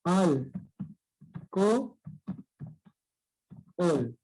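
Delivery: "Al, co, ol" with long pauses between syllables. Shown as a demonstration. Teacher dividing alcohol for pupils, syllable by syllable. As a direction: break it into "Al", "co", "ol". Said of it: "se separan".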